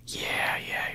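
normal talking voice

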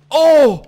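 loud, high "oh" of shock; sudden yelped exclamation; startled cry. Real, not acted. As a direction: cry out at the hit. Reacts to hit in game.

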